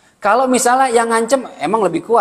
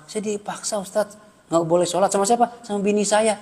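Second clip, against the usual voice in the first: soft-voiced henpecked husband; mock meek complaint; 2 semitones higher, 6 dB quieter than usual. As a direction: confess it softly to the ustadz. Acts a henpecked husband confessing. (mengadu pelan, pura-pura tak berdaya)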